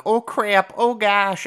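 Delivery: high, loud imitation of a Minnesotan; hammed-up Upper Midwest accent. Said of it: "based in this part of the country without saying".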